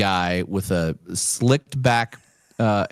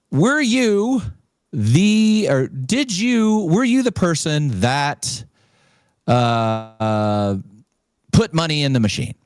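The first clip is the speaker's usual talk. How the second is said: much higher, halting questioning voice; official questioner; 5 semitones higher, slower than usual. Retold or imitated. Caller interrogates the winner, high halting official. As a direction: probe like a stiff investigator.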